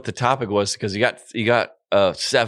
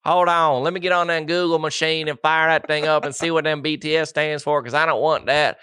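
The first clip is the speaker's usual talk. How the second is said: higher-pitched Southern parent voice; Southern accent; 5 semitones higher than usual. Pretend. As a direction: play a worried parent with a thick Southern accent. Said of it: "We've done a Southern accent".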